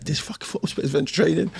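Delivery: high, fast, exasperated grumble; mock indignant complaint. To his mate about the hike, a high fast exasperated moan. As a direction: rattle it off, high and fed up.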